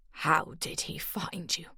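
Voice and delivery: unvoiced, strained whisper. A narrator's reading. Morag asking about her son in a strained whisper. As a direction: force it out in a tight whisper. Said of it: "Her vocal cords sounded strained".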